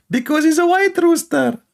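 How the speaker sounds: squeaky, mocking uncle voice; high mock uncle voice. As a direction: pitch it up high like a naive old uncle answering. Mocking retold dialogue with uncle.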